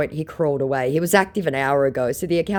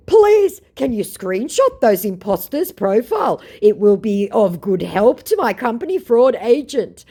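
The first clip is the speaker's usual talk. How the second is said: high, loud, hammed-up plea; mock pleading; 8 semitones higher, 7 dB louder than usual. Fake celebrity asks a fan for screenshots, mock pleading. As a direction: open on a big stretched please, then beg.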